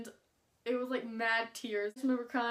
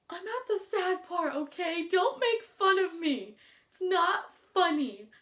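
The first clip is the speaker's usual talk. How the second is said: higher and louder, slowing into a whiny, tear-choked protest; tearful defensive plea; 5 semitones higher, 4 dB louder than usual. Retold or imitated. To the classmate teasing her for crying, a higher, louder whiny plea. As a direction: beg him not to laugh while still crying over the book. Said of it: "I'm like".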